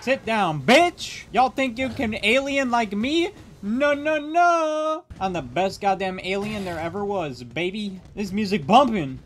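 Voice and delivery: high, cocky alien taunting the lobby; high alien trash-talk voice. Acted. Taunting the other players in the game, high cocky alien voice. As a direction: strut and brag like the best alien there ever was.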